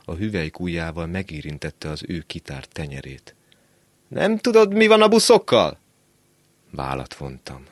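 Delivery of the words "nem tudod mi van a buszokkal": high, loud question called out; boy shouting a question to a passerby; calling across. Acted. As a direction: call over to a stranger. Boy calls question across street.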